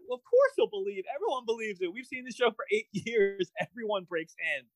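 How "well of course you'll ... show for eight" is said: normal talking voice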